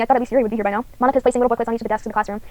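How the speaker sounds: usual speaking voice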